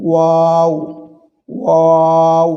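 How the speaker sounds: ওয়াও as a long "waaaw" on one note; ওয়াও loud, steady and drawn out; rounded-lip articulation. Shown as a demonstration. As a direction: round the lips and hold ওয়াও out. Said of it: "একটু গোল করে এটি উচ্চারণ করতে হয়".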